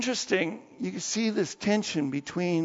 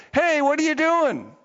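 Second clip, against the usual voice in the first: high, louder donkey outburst; indignant donkey; 8 semitones higher, 4 dB louder than usual. Acted. Donkey confronts the master beating it, indignant. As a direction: protest loudly as the beaten donkey. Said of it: "then the donkey says".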